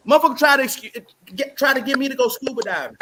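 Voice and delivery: ordinary talk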